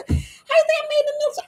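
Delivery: very high, fast mimicking voice; mock incredulous. Retort about making it in the military, high incredulous. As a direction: squeak it out quickly in mock disbelief.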